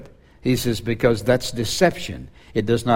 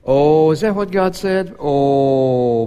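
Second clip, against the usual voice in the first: high, loud, mock-innocent serpent voice; preacher voicing Satan talking to Eve, high and sly; Satan tempting Eve; 4 semitones higher, 4 dB louder, slower than usual. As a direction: put on a sly, feigned surprise. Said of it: "he started out with that with Eve".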